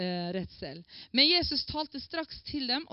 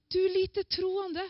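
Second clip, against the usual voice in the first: higher, very slow reproachful question; gentle rebuke; 8 semitones higher, much slower than usual. Retold or imitated. Jesus to Peter, who has just sunk: slow rebuke. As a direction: stretch each word like a disappointed teacher.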